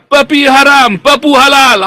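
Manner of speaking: loud, high villain gloat; sneering panto villain. Acted. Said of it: "tertawa jahat".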